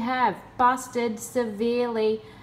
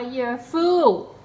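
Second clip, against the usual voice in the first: scornful jeer; 4 semitones higher, 5 dB louder than usual. Real, not acted. Mocking the scammer after laughing.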